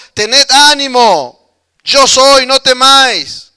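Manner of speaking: slow, loud call across the water to calm them; Jesus calling to his frightened disciples over the storm, slow and loud. Retold or imitated. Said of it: "Jesús ahí gritándole también".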